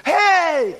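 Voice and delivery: high, loud call for attention, easing in the middle, then booming at the end; emphatic attention-grabbing shout; emphatic John. Acted. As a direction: call out urgently as John, punching the final words. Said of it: "As if John were saying here".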